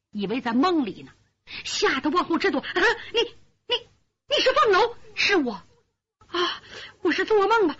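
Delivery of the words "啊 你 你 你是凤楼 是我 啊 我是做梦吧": put-on stunned old mother. Disbelieving mother.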